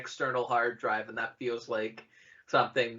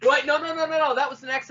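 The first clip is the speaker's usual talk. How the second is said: surprised; 12 semitones higher, 8 dB louder than usual. Real, not acted. Sudden correction, realizing mistake.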